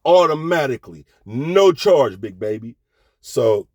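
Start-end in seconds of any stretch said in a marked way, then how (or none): none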